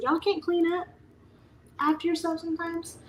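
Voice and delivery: high, disbelieving put-on voice; fed-up mom scolding the kids, pitched high; exasperated mom. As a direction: ask it like you can't believe them. Mom exasperated at kids' mess.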